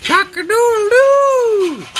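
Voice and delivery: high, loud, long-held crow; loud drawn-out rooster call. Pretend. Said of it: "What sound?".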